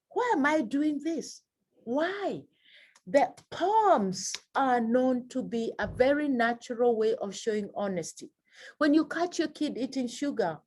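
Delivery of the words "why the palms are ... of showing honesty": normal talking voice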